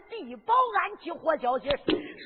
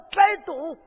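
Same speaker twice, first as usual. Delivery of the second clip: barked, much louder 别动; sharp command; 8 dB louder than usual. Acted. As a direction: snap the order at her. Hero threatens captive at knife. (厉声喝道)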